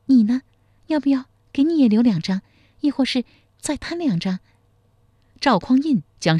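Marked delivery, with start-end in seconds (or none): 0.1-4.4 high, bright-voiced young wife asking with concern; caring young wife. A narrator's reading. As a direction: lift into a caring young wife's voice. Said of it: "一脸关切地问道".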